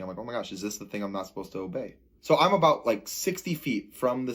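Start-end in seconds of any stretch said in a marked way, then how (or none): 0.2-1.9 quick, hushed retold self-questioning; fast, low-key inner alarm. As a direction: rush it softly under the voice. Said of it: "I'm, like, questioning".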